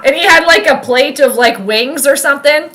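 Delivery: usual speaking voice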